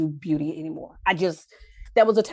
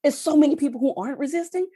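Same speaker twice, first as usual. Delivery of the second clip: defiant; 8 semitones higher, faster than usual. Real, not acted. Defiant about resisters.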